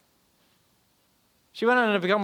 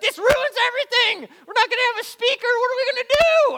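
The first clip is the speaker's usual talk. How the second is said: high, loud frantic whine; panicked students; 11 dB louder than usual. Retold or imitated. Students panicking that their speaker never came, high frantic wail. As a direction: squeal it out like students in a total panic. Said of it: "They're just like".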